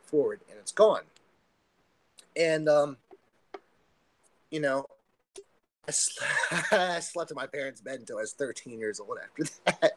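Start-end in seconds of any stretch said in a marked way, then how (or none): 5.9-10.0 real laugh breaking into the words; laughing admission. Real, not acted. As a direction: confess it through a self-amused laugh. Laughs at own childhood confession.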